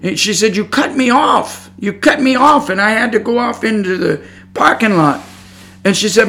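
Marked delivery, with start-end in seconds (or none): 0.6-5.2 retold yelling. Woman accusing him of cutting her off, mock yell. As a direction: imitate an upset driver complaining, pitched up. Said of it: "she was yelling at me".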